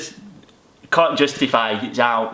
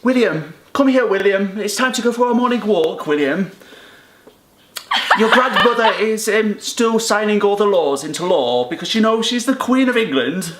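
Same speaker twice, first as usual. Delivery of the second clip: put-on higher, plummy upper RP accent; 5 semitones higher than usual. A posh RP accent as the Queen's grandson. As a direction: call William like a royal in RP. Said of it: "upper R P accent".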